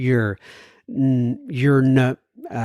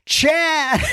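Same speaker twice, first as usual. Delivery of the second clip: excited call; 11 semitones higher than usual. Real, not acted. Calling out the next letter writer.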